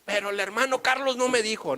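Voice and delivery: mock the excuse of the damned; a hint of a protesting sinner; whining sinner in hell. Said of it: "va a decir".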